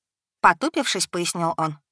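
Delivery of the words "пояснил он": ordinary delivery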